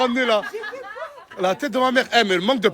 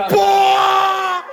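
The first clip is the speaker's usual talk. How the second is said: loud disgusted gasp; 10 semitones higher, 5 dB louder than usual. Real, not acted. Reacting to shown abs.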